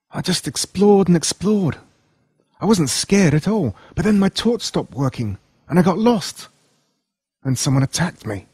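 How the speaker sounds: put-on a shaken kid telling what happened; Jolin's voice. Lost boy telling his friend about the attack, shaken.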